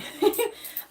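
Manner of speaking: breathy laugh. Real, not acted. Laughs at calling herself lazy.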